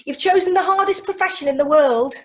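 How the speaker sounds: know-it-all warning. Retold or imitated. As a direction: deliver it like unwanted advice. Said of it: "or they say".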